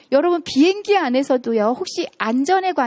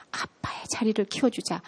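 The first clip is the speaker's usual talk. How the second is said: whisper; 2 semitones lower, 9 dB quieter than usual. Real, not acted. Lecturer urging mothers.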